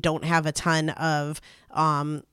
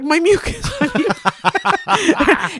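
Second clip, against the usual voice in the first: high, laughing, stretched-out wail; laughing whimper; 10 semitones higher, 3 dB louder, much slower than usual. Retold or imitated. Holding her hand to her partner, high laughing wail. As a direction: drag out the last word, giggling and high. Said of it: "being like".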